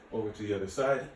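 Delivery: usual speaking voice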